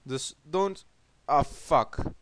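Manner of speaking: normal talking voice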